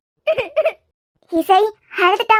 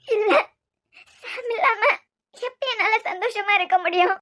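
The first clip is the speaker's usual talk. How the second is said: tearful sobbing; 4 semitones higher, 6 dB quieter than usual. Acted.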